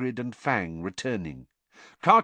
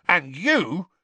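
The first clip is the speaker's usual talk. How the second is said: angry turn on Harry; angrily; 8 semitones higher, 4 dB louder than usual. Acted. Hagrid to Harry, turning angrily. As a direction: round on him sharply. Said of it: "said angrily to Harry".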